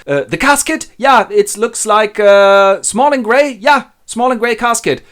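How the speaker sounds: high, loud, excited exchange; scheming servant and frantic miser. Acted. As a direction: improvise an accusation in an agitated, high voice.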